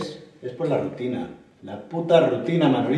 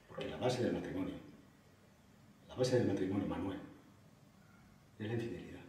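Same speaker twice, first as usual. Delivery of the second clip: very soft, low, halting voice; subdued confession; 5 semitones lower, 14 dB quieter than usual. Acted. Husband confessing to Manolo about his wife, subdued. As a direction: confide it quietly, with long pauses. Confesses acceptance of wife's possible infidelity.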